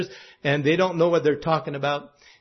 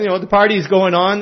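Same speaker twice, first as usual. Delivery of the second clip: ordinary talk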